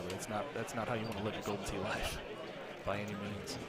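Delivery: golf commentator. Acted. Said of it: "Don't use the golf commentator voice".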